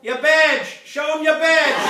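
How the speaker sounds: loud, high yell across the field; shouting from the fence. Acted. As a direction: holler it gleefully at the fleeing agent. Farmer yells from fence at agent.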